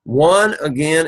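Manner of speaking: ordinary delivery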